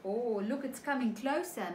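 plain reading voice

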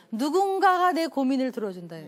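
ordinary talk, nothing marked

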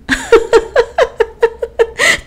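high, rapid peal of laughter; sudden fit of high-pitched laughing; bursting into laughter. A narrator's reading. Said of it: "Eleanor rompió a reír".

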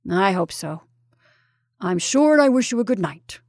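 put-on loud, emphatic old-soldier warning; blunt Colonel. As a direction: give a firm, weighty opinion as the Colonel, then sign off. The Colonel gives his blunt warning.

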